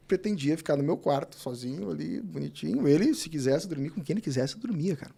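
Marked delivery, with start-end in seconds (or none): none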